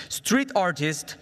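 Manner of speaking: ordinary talk, nothing marked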